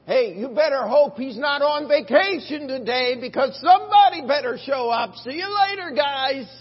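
loud, high teasing jeer; a loud high heckle at the prophets; loud mocking taunt. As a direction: heckle them with glee.

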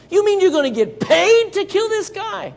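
high, loud incredulous question; amazed disbelief. Acted. David learning of the reward from the soldiers, amazed disbelief.

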